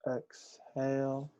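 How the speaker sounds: soft, slow breath cue; drawn-out cue. Shown as a demonstration. Teacher bringing the class back to center, soft and slow. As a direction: draw the word out quietly like a long breath out. Modelling a long exhale for class.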